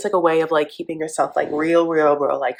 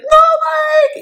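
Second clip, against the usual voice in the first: much higher, loud "No, Mike!"; shrill mock Natalie; 5 dB louder than usual. Host as Natalie shrieking at Mike. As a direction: shriek it at him.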